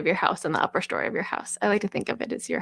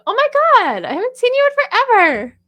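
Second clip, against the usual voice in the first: much higher and louder thrilled greeting; excited greeting; 12 dB louder than usual. Retold or imitated. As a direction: spot an old friend and squeal hello. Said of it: "if you see a friend down the street, you go".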